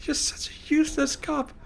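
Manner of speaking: high, whiny complaint; exasperated whine. Real, not acted. Streamer whining at the useless in-game cop.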